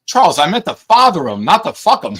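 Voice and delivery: high and loud, cracking up; exasperated shout. Retold or imitated. Dad yells a correction at his literal-minded son, high and loud.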